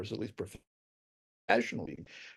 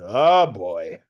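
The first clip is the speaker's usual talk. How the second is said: put-on Mel Brooks's Yiddish accent; 6 semitones lower, 4 dB louder than usual. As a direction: groan it low in a Yiddish accent. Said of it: "goes in a Yiddish accent".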